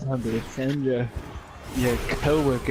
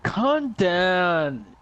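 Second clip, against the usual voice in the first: raised-pitch mock exasperation; exasperated disbelief; 6 semitones higher, slower than usual. Gamer doubting a friend's zombie story, exasperated. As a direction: tell them off in disbelief.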